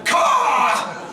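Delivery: shouted bird caw; crow caw. Acted. Davos as crow.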